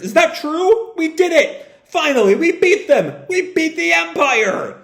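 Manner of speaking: high-pitched jubilant acted cheer; hammed-up, elated high cries. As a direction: celebrate a victory in disbelief. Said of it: "Oasis cheers".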